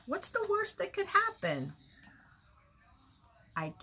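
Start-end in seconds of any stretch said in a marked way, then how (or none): 0.1-1.7 lightly voiced mom, pitched up; a hint of a breezy mother, higher; imitating mom. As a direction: mom brushing off the worry, lightly mimicked. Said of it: "She says, my mom".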